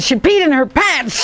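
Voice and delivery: quoting through laughter; giggling while retelling; laughing. Retold or imitated. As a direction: laugh out the words. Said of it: "She said".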